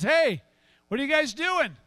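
high, loud call from the shore. Acted. Man on shore hails fishermen, calling out. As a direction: hail some fishermen across the water.

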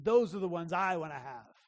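usual speaking voice

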